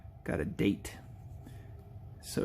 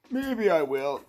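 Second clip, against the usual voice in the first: high, playful put-on voice; silly put-on voice; 7 semitones higher, slower than usual. Host teases doing the voice again, high put-on voice. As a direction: slip back up into the silly voice. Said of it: "maybe I will do that voice again".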